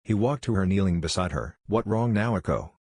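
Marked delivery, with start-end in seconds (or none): 1.7-2.7 put-on slow, gentle words to a crying girl; kind and smiling. A narrator's reading. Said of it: "smiling at her kindly".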